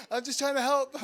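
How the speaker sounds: high, laughing, mock innocent; innocent plea. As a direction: narrator to the startled man, laughing plea. Narrator pleading with robbed man.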